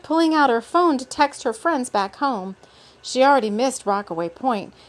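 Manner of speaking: ordinary delivery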